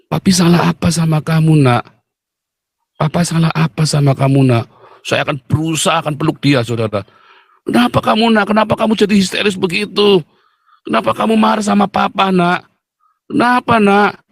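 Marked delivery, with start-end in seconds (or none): 0.1-4.6 raised-pitch fatherly pleading; hurt, sad. Acted. Father asking his child what he did wrong, sad. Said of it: "saya akan sedih sekali". 7.6-14.2 high-pitched acted anguish of a father; tearful pleading. Father begging his hysterical child to explain, distraught. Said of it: "saya akan nangis".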